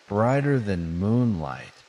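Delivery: usual speaking voice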